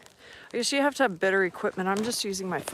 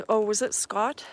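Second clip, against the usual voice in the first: ordinary delivery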